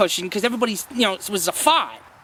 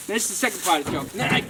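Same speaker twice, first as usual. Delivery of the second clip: usual speaking voice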